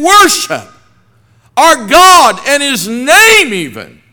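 loud, high, slow pulpit preaching; preacher proclaiming scripture to the congregation, loud and slow; full-force preaching. Acted. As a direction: thunder the commandment out to the congregation.

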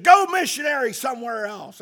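retold wife yelling at the missionaries; imitated angry shout. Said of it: "she said".

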